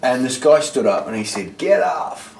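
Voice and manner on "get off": retold heckle. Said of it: "like that".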